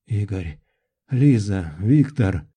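names rasped out low, one by one; low, strained calls after a nightmare; hoarse croak. A narrator's reading. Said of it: "прохрипел я вслух". (хрипло и низко, с паузами)

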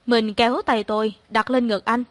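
plain reading voice

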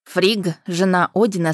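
plain reading voice

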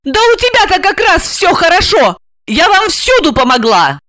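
high, indignant heroine voice; angry protest. A narrator's reading. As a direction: protest hotly, pitched high. Angry quarrel, she protests. (сердито, высоким голосом)

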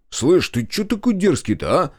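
high, slowed, bristling street challenge; bickering threat. Acted. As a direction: square up to the cheeky one. Oleg and Kolyan bicker. (задиристо, с вызовом, медленно)

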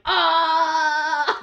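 loud, high, drawn-out "aah"; re-enacted scream of amazement, held long; long excited scream. Re-enacts reaction to the reveal.

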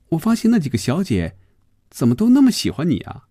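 put-on high-pitched young woman's voice; Phoebe, curious and probing. Phoebe asks Hongjun about the girls, probing. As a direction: question him in a high female voice.

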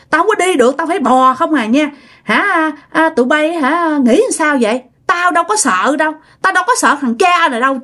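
high, taunting spirit voice; mock and curse the family from the bed; possessed cursing. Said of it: "Bà chửi sao các bạn biết không?". (giọng ma nhập chửi khiêu khích, cao giọng)

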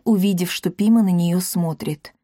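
usual speaking voice

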